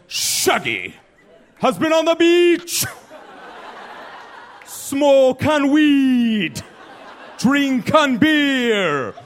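bad Jamaican accent. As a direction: stretch every word in a bad Jamaican accent. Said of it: "I gotta do a Jamaican accent".

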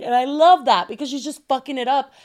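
ordinary delivery

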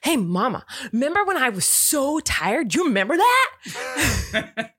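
put-on high, bouncy little-kid voice, slowing on the question; excited toddler. Toddler bouncing on the bed telling mama, high excited kid voice. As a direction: play the hyper toddler proudly claiming he was tired. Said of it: "He's like".

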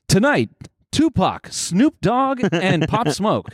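high, swooping event-promo voice; mock show announcer. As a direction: sell it like a hammed-up show announcer.

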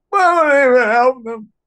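very high, loud tearful wail; imitating a sobbing girlfriend; crying girlfriend. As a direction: sob out the plea.

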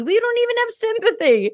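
high-pitched, laughing exasperation. Real, not acted. Guest jokes to host about missing sympathy, laughing. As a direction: let the punchline break up into high laughter. Joking punchline, both laughing.

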